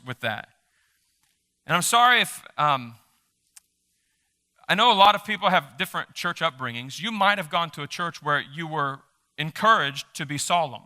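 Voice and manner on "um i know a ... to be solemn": ordinary talk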